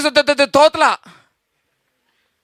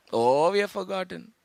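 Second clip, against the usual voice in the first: much softer, lower rueful confession; softer, lower confession to the church; hushed admission; 7 semitones lower, 12 dB quieter than usual. Acted. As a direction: say it softly to yourself. Repeated, first take hushed.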